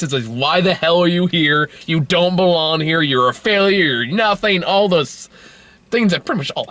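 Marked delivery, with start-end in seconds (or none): none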